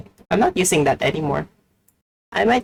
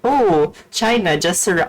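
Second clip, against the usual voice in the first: ordinary talk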